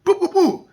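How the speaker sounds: string of high mock farts; high, repeated "poo" imitating wind; imitated fart noises. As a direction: pop out comic little fart sounds. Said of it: "and just trumped".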